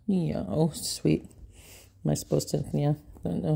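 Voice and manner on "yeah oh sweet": ordinary talk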